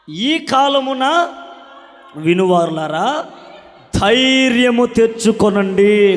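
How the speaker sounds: loud, slow, high proclaiming of scripture; slow fiery sermon reading; preaching scripture. Acted. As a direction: thunder the verse out slowly to the congregation.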